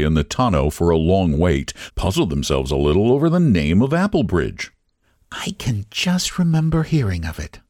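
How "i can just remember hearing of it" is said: drowsy, higher older lady, lightly voiced; sleepy lady. A narrator's reading. Lady dozing in the car, sleepily recalling a village. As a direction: drift off mid-remembering. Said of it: "said Missus Dunning sleepily".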